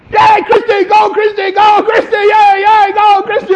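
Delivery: high, loud cheering shout. Retold or imitated. Jerry shouts from behind the scoreboard at the walker, cheering.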